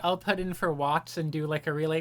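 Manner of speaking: ordinary talk, nothing marked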